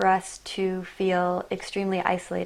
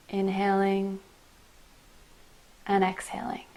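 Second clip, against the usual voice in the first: guided meditation. Acted. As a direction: guide them gently inward, breath by breath. Leads a meditation.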